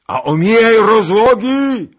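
long, high, full-voiced yell; shouting after him. A narrator's reading. Zagloba shouts to the departing rider, long loud call. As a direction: yell it across a widening distance. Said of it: "krzyknął mu na drogę Zagloba".

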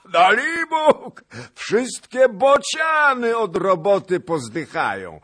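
very high, loud, cackling quip; laughing shout. A narrator's reading. Zagłoba joking to the laughing gentry, loud and laughing. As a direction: crack the joke with a roaring laugh. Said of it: "wołał pan Zagloba".

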